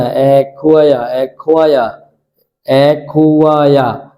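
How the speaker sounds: অ্যাকোয়া drawn out slowly, syllable by syllable; slow model of অ্যাকোয়া for students; teacher slowly demonstrating অ্যাকোয়া; careful pronunciation. Shown as a demonstration. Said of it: "এই উচ্চারণটা করতে পারে না".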